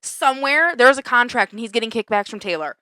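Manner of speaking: ordinary delivery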